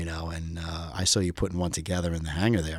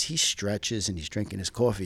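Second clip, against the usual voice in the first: usual speaking voice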